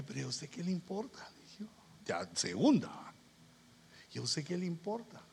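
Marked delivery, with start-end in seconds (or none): 0.0-1.3 restrained rebuke. Retold or imitated. Retold rebuke, repeated softly. 4.1-5.2 restrained rebuke. Retold or imitated. Retold rebuke, second time.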